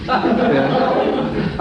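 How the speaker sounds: ordinary talk, nothing marked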